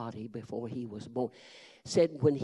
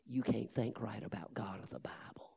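soft, much quieter whisper; 2 semitones lower, 7 dB quieter than usual. Retold or imitated. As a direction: say it gently under the breath. Preacher speaking softly to the youth.

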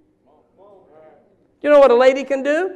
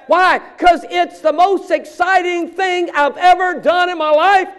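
rising, louder and higher preacher's call; fiery preaching; 4 semitones higher, 4 dB louder than usual. Real, not acted. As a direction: preach it with growing fire. Preacher building to full force.

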